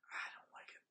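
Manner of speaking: unvoiced anxious whisper. Real, not acted. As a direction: whisper it nervously. Whispered dread.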